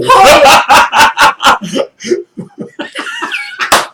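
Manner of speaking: big burst of laughter; loud laugh. Real, not acted. Laughing at partner's peanut reaction.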